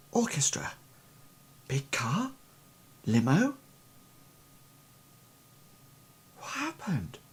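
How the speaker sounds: soft, whispered fragments; hushed, dazed half-whisper; trance whisper. Acted. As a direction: whisper as if grasping at lost memories. Boy murmurs fragments in a trance.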